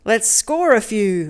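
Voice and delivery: ordinary delivery, nothing marked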